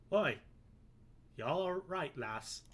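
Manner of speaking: a hammed-up, horrible Irish brogue; bad Irish accent. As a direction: play the Irish sailor, badly on purpose. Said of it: "I've made him Irish. Like, horrible Irish".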